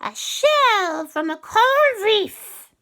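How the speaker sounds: very high, slow, loud witchy bargain; sly and drawn out, bargaining; high cat witch. Acted. Cat witch demanding a favour from the children, high and sly.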